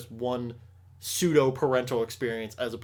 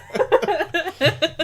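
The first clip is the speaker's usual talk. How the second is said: high squealing burst of laughter; excited high laugh; 7 dB louder than usual. Real, not acted. Host excited that Pokemon comes out tomorrow, high laugh. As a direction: squeal with delight.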